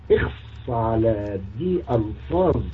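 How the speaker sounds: low, slow, soft disgust; slow disgusted tut. Real, not acted. Reacting to a word with slow low disgust. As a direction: drag out the disgust.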